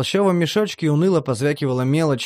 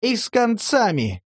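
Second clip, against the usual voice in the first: higher, a mild bark; 9 semitones higher than usual. A narrator's reading. Vena barks to end the talk, mild higher bark. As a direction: snap it shut. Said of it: "рявкнул Вена".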